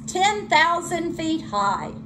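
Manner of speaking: ordinary delivery, nothing marked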